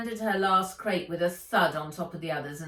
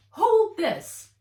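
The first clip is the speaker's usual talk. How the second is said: much higher, louder curt command; brisk order; 12 semitones higher, 11 dB louder than usual. A narrator's reading. Fred handing Nat tweezers, with a high loud curt order. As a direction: snap the order.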